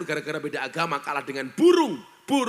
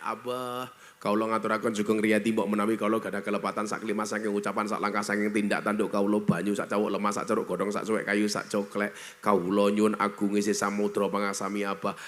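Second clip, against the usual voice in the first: bow low and speak with refined humility to the elder; refined humble wife; 9 semitones lower than usual. Retold or imitated. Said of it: "mau halus kayak".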